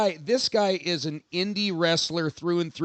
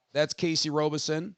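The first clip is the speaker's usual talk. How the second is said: ordinary delivery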